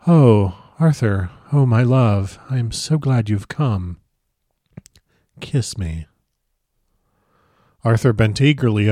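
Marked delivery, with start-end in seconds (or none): 0.1-6.1 languorous, seductive invitation, lightly acted; a touch of sultry allure, drawn out; soft, voluptuous. A narrator's reading. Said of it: "in a soft, voluptuous voice".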